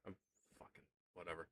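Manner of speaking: quiet annoyed mutter; muttered to self. Real, not acted. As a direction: grumble it under your breath. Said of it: "annoyed at the breaker".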